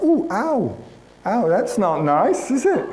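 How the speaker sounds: high, swooping wounded "ow"; slow, high-pitched comic offence; mock hurt. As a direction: whimper it as a hammed-up ouch. Acts being hurt by his remark.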